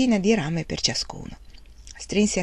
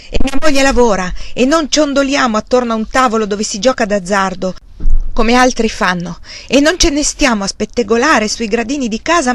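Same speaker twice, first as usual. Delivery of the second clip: loud angry outburst, a bit higher; shouting; 5 semitones higher, 8 dB louder than usual. A narrator's reading. Wang Lung shouts back at his idle uncle, angry. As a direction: finally explode at him. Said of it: "improvvisamente gridò".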